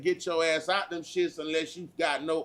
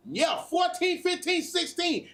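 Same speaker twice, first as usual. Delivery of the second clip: shouted ages; 12 semitones higher than usual. Real, not acted. Repeating ages for emphasis.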